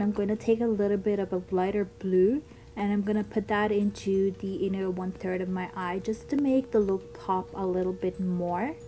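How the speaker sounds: ordinary talk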